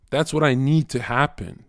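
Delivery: ordinary talk, nothing marked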